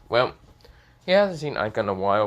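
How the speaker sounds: plain reading voice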